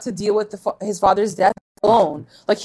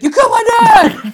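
much higher, louder half-laughing yell; hammed-up grief shout, laughing through it; mock accusing shout; 13 semitones higher, 8 dB louder than usual. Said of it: "He's like".

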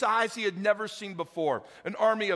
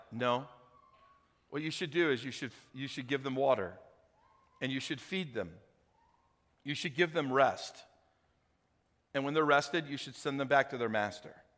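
much lower and softer, steady; calm counsel; 6 semitones lower, 5 dB quieter than usual. Retold or imitated. Elisha calmly advising the king, much lower and softer. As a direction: gently talk him down. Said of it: "And Elisha's like".

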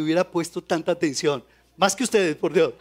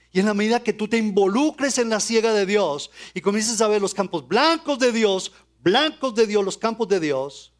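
ordinary talk, nothing marked

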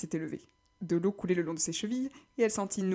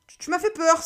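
effrayé; 9 semitones higher, 6 dB louder, slower than usual. Acted. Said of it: "s'écria Cali".

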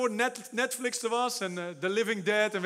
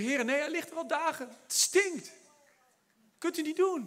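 sister's pitched-up protest, a good bit higher; high objecting sister; 6 semitones higher, 3 dB quieter than usual. Acted. Sister protesting to Jesus at the tomb, voice high and pleading. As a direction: whine that it already stinks.

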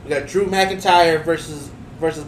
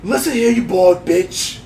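a slow, loose Scottish growl of a threat; half-hearted Scottish accent, slow and mocking; Drew McIntyre Scottish accent; slower than usual. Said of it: "that's my best Bo-, uh, Drew, Drew accent".

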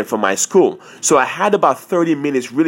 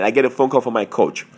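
ordinary delivery

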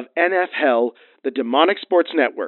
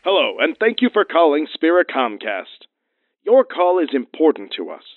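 polished recorded hotline voice; phone menu voice; 2 semitones higher than usual. Acted. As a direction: read it like an automated menu.

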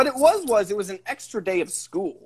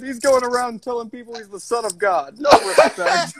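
lightly voiced put-upon comic, higher; Rodney Dangerfield impression; 7 semitones higher than usual. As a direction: complain like a no-respect comic.

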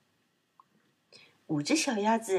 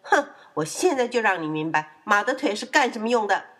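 loud, huffy mother horse; huff, then threaten indignantly; angry; 5 dB louder than usual. Acted. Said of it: "小马的妈妈生气地说". (生气地威胁)

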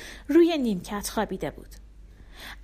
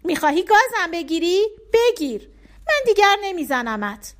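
put-on high, loud calling-out little boy; child shouting; 10 semitones higher, 7 dB louder than usual. A narrator's reading. Bardia calling out to Afshin as he comes home, loud and high. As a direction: shout through the house for his brother. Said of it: "بردیا فریاد زد".

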